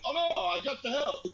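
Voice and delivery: high mock grandma voice; grandma refusing. Grandma refusing to sit down, high mock brush-off. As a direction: brush them off like a busy old lady. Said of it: "we're like".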